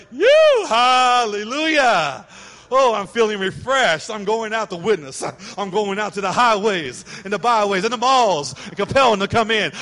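mock-preach your joy at the sale; revived shopper. Wife rejoices over the mall sale, hammed-up ecstatic. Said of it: "you're like".

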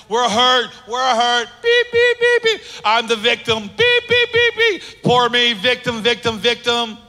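mock whiny victim with high beeps; mock the self-pitying complainer. Pastor mocking the congregation's victim complaints, whiny and shrill.

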